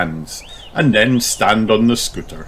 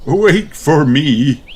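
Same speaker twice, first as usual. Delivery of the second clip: slow, drawn-out call; Daddy Pig calling; 7 semitones higher, 5 dB louder, much slower than usual. Acted. Daddy calls after the scooting children, slow.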